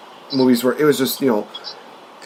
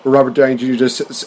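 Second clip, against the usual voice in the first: normal talking voice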